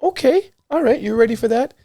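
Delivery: high-pitched, impressed questioning; surprised parent. Retold or imitated. Father acts his surprise at daughter.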